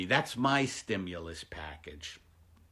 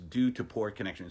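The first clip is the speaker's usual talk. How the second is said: ordinary talk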